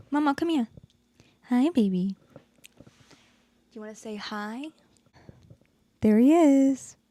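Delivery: high, sing-song voice for the dog; pet talk. Real, not acted. Talking to her pet Momo.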